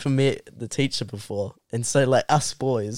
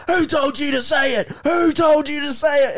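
high, shouted mock interrogation; angry teacher; 13 semitones higher than usual. Teacher grilling the new kid, angry. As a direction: imitate a furious teacher demanding answers. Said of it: "he gets so angry".

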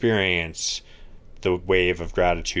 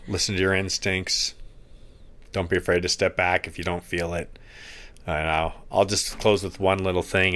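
normal talking voice

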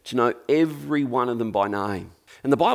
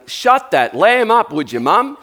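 grumpy dad sheep; 8 semitones higher, 9 dB louder than usual. Acted. Dad sheep telling mum to quieten the lamb, loud grumbling. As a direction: bark it at mum as the fed-up dad. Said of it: "That's dad sheep saying".

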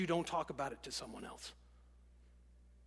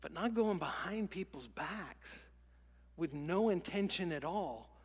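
usual speaking voice

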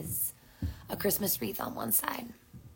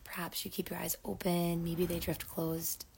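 unhurried meditation voice with long pauses; guided meditation. Acted. Host settles listeners in to breathe and rest, guided meditation.

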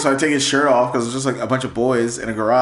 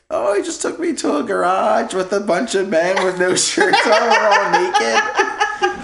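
put-on high, innocent child's report, laughing; imitated tattling kid; 9 semitones higher, slower than usual. Nephew reporting the garage visit at home, imitated tattling kid. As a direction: mimic a kid cheerfully telling on uncle. Imagined nephew telling parent, hammed up.

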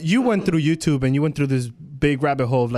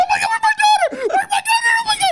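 mock screaming mom; 3 dB louder, much faster than usual. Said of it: "She is screaming".

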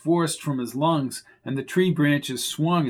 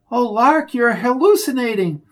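alarmed; 9 semitones higher, 6 dB louder than usual. Acted. Flo alarmed at dazed Lark.

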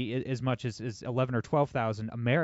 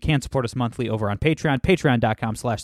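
podcast host intro; 10 dB louder than usual. Acted.

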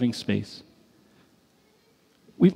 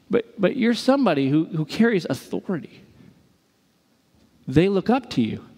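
ordinary talk, nothing marked

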